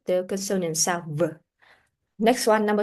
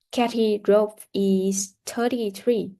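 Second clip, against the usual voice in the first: ordinary talk, nothing marked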